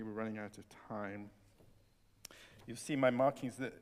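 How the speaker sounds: usual speaking voice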